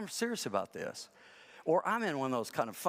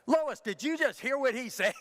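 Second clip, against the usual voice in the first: mock outraged yell, higher and louder; 10 semitones higher, 4 dB louder than usual. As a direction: throw it at the screen in disbelief. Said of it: "I yell at the T V".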